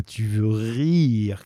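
slow scornful jeer; mocking cry. Javert mocking Valjean's plea with a slow scornful jeer. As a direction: jeer at the request. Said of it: "cria Javert".

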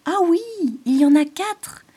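very high, loud bird cry of discovery; excited cry. Acted. Robin Hector spots the four seeds, high and loud. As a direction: chirp out the count thrilled. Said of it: "s'écria le rouge-gorge Hector".

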